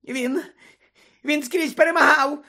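high, squeaky, panicked excuse; cowering high-pitched plea; gasping Pettigrew. Acted. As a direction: stammer it out in a thin whimper. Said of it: "видихнув Петтігру".

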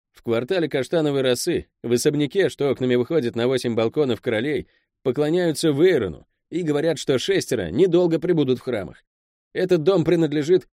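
high-pitched underling reporting to his boss; Pel, the deferential henchman. Acted.

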